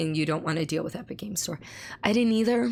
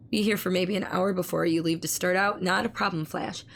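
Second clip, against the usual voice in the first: usual speaking voice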